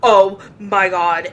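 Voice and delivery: loud, slow "oh my God"; excited exclaim. Retold or imitated. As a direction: gasp it out, thrilled and loud. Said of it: "you're like".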